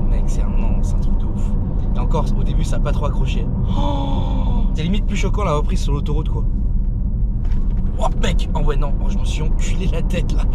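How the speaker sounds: loud, buzzing with adrenaline; excited. Real, not acted. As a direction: still reeling, talk it out loudly. Reacting to acceleration thrill. (excité et abasourdi)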